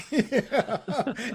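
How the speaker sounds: high-pitched giggle at own bad accent; laughing. Real, not acted. Host amused by his own silly impression, laughing.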